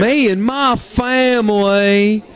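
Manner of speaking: hammed-up Southern accent impression; Foghorn Leghorn. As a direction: stretch it out in an exaggerated fake Southern accent. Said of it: "turned into Foghorn Leghorn".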